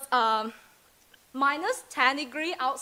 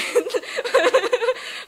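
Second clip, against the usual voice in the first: laughing; 10 semitones higher, 3 dB louder than usual. Real, not acted. Laughing at own joke.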